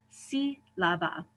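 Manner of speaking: "sílaba" slowly as SI-la-ba; third-to-last stress. Shown as a demonstration. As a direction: draw out the first syllable of "sílaba". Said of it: "These emphasize the third to last syllable".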